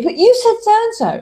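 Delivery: high indignant protest; mock accusing partner. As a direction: squeak out the accusation. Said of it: "they'll say".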